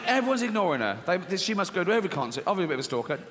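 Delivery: ordinary talk, nothing marked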